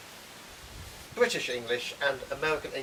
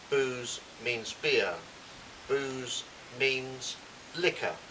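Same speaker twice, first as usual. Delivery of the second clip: slow, deliberate Booze means beer; slow teaching voice; much slower than usual. Shown as a demonstration. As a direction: spell it out slowly for learners. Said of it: "We'll do this nice and slowly".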